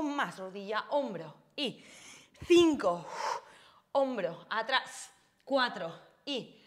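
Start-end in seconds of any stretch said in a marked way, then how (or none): 2.4-3.1 counting out loud and strong over the music. Real, not acted. Instructor to class mid-set, loud called count. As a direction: punch the count so the class hears it.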